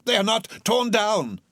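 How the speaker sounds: high, loud joyful exclaim; excited cry. Acted. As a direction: cry it out in relief. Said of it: "cried Scrooge".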